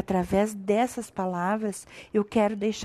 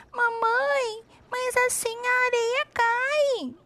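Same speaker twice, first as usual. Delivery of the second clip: put-on little girl voice; 5 dB quieter, slower than usual. Girl speaks to her mother.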